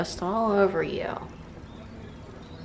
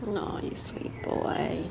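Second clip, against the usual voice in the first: whisper; 2 semitones higher, 8 dB quieter, slower than usual. Real, not acted. Cooing at cat.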